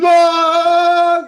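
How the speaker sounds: sudden high loud scream. Acted. Terrified character screaming at the staring host, loud shriek. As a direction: shriek in terror. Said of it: "you start screaming again".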